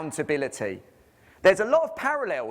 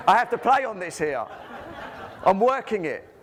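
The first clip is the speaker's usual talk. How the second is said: laughing; 5 semitones higher than usual. Real, not acted. To the audience about playing up his accent, laughing. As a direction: laugh it off while admitting it.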